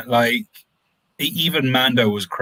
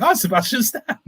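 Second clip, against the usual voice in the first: surprised realization; 11 semitones higher than usual. Retold or imitated. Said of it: "and then my head went".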